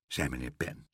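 ordinary delivery, nothing marked

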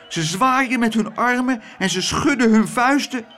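high, excited alarm; worked-up woman pointing out the crowd; agitated Klaterhoen. A narrator's reading. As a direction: chatter anxiously in a high voice. Agitated Klaterhoen describes the angry crowd.

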